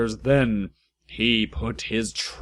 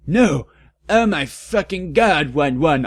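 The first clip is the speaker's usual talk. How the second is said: louder, higher frightened cry; scared shout; 5 semitones higher, 4 dB louder than usual. Acted. Ebony wakes from a nightmare, shouting in fear. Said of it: "I shouted in a scared voice".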